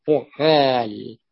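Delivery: فعال slow, with a long double ع; doubled middle consonant. Shown as a demonstration. Teacher matching the verse to its pattern, slow doubled ع in فعال. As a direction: draw out the geminated ع in فعال. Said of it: "بالتشديد أو التخفيف".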